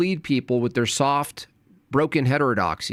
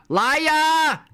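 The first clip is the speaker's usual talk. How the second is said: high, loud yell of liar; a sudden shrieked accusation; shouted accusation; 4 dB louder than usual. Real, not acted. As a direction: bellow it at the chat. Calling out liar in chat.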